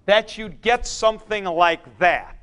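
ordinary delivery

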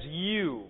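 u said "you", as in "feud"; alternative pronunciation. Shown as a demonstration. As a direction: say u as a long you. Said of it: "as in feud".

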